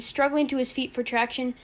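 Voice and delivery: ordinary delivery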